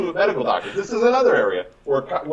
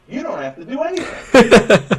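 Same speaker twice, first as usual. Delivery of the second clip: laughs through the line, higher and louder; laughing; 7 semitones higher, 7 dB louder than usual. Real, not acted. Answering a patient's worry, laughing. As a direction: chuckle as you brush off the worry.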